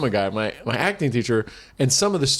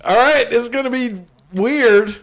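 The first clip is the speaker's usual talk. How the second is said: higher, louder awkward declaration; hammed-up nervous actor; loud nervous announcement; 9 semitones higher, 5 dB louder than usual. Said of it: "I get up and I go".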